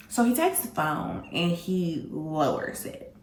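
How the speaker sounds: ordinary delivery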